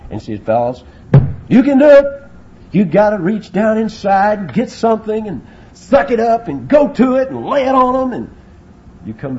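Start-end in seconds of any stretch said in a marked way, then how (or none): 0.4-8.1 high, loud rallying shout; coach's pep talk. Acted. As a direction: fire up the team like a coach. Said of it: "But I've heard 'em do it".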